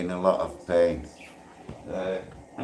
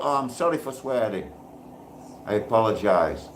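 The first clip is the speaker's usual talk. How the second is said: ordinary talk, nothing marked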